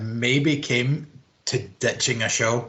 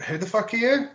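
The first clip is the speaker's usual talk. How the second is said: exasperated; 8 semitones higher than usual. Retold or imitated. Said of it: "it's like".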